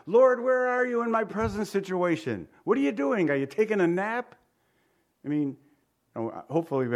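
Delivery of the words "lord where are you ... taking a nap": higher, whiny questioning of God; complaining believer. Pretend. Preacher voicing a believer complaining to God, higher. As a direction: nag God a little. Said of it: "how often do we wonder".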